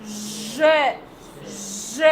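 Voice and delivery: ज held low and soft with the throat buzzing, a zh sound; voiced zh buzz. Shown as a demonstration. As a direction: let ज vibrate in the throat like zh. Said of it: "हमारे गर्दन में यहाँ पर विब्रेट होगा".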